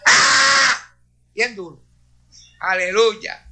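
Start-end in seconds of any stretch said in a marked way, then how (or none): 0.1-0.9 loud, high imitated yell; imitating the wizard's beastly scream, loud and high. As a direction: belt out a savage cry. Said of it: "un grito bien bestial".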